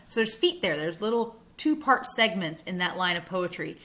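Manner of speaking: normal talking voice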